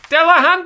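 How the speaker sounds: Delahunty yelled high and loud, every syllable punched; shouted Delahunty. Shown as a demonstration. Host shouting the MP's name for fun, loud and high. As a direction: belt out Delahunty like a cheer. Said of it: "That's how I've been saying it lately".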